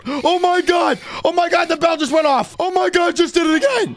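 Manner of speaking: high, rapid, breathless-with-news exclaiming; excited alarm. Acted. Ghost hunter seeing the bell go off, high excited alarm. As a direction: cry out at a thrilling discovery.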